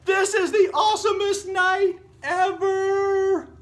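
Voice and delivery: very high, loud, slow whoop of joy; joyful shout. Acted. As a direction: shout it to the whole stadium. Gideon's big joyful cry.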